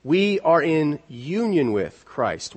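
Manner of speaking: ordinary talk, nothing marked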